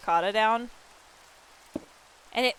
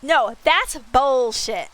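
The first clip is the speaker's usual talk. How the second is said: slow, louder indignant protest; retold protest; 4 semitones higher, 7 dB louder, much slower than usual. Streamer retelling how she protested to her friend, slow indignant yell. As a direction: drag it out, loud and offended. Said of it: "I'm like".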